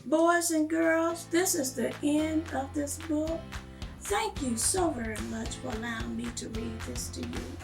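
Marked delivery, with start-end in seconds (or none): none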